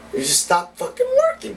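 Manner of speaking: excited. Real, not acted. Ranting call to stop working.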